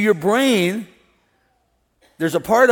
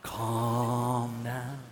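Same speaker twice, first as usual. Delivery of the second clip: much softer, low soothing calm down; 6 semitones lower, 11 dB quieter than usual. Shown as a demonstration. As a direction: ease them down gently. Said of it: "You said it so calm. It's like I'm in a spa".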